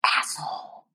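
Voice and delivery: whispered swear. Real, not acted. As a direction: whisper the curse word.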